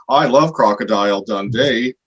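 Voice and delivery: mocking, hammed-up fake Aussie accent; overcooked Aussie accent, drawn out; bad Australian accent. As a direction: do a douchey attempt at an Australian accent. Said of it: "trying to do your accent back to you".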